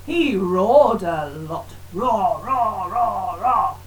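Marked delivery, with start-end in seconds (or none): 1.9-3.8 low, loud roaring lion. Acted. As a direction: roar like the king of the jungle. Lion roars in story.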